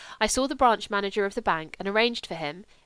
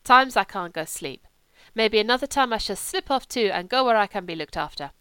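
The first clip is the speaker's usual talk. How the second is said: put-on lisping, grumbling German-accented voice; German accent, querulous complaint; complaining Kurt; 2 semitones higher, 4 dB louder than usual. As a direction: play a sulky German invalid.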